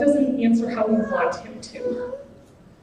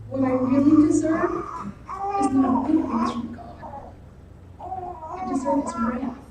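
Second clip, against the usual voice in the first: tearful confession; 4 semitones higher, 4 dB quieter than usual. Real, not acted. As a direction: voice breaking into tears, softening. Confessing sin, voice breaking.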